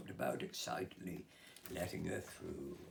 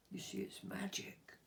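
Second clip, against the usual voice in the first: fairy's secret; 13 semitones higher, 4 dB quieter than usual. Acted. Fairy voiced in story.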